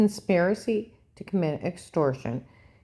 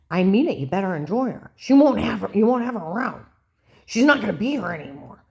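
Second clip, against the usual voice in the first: higher, louder mock threat; insistent taunt; 5 semitones higher, 6 dB louder than usual. Ex-husband threatening the grandson about Grandma, insistent and raised. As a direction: press it home, imitating a menacing relative.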